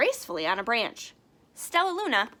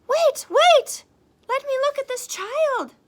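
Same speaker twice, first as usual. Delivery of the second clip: very high, loud urgent call; urgent mother bat; 10 dB louder than usual. Acted. Mother Bat pushes through the crowd, high and loud. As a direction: push forward calling out.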